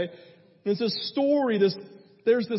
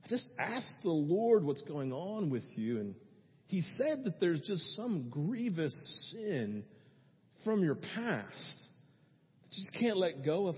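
soft, measured retold revelation; hushed, deliberate words to one person; gentle prophetic word; 3 semitones lower, 7 dB quieter than usual. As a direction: deliver it quietly, with weighty pauses. Prophet addresses woman about sin.

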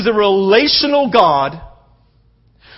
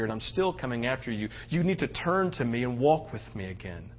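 soft sermon voice of God; 9 semitones lower, 13 dB quieter than usual. Acted. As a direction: lean in and whisper grace to them. Speaks softly as God.

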